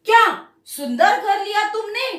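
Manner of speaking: क्या pressed hard, the rest of the line lighter; extra weight on क्या, rising at the end; pressure on क्या. Shown as a demonstration. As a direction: lean on the word क्या. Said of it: "उसके ऊपर क्या करते हैं, हम थोड़ा प्रेशर देते हैं".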